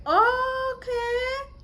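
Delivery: very high drawn-out okay; stunned okay. Retold or imitated. Bride realizing it was the receptionist, high drawn-out okay. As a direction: let it dawn on her, high and long.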